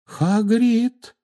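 very high-pitched, anxious call; high frightened voice. A narrator's reading. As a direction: call out fearfully in a high girl's voice. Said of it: "сказала Гермиона высоким испуганным голосом". (высоким испуганным голосом)

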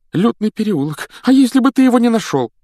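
very high, loud, fast worry; can't calm down; still upset. Acted. Mrs Weasley frets over Harry, loud and fast. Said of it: "не могла успокоиться".

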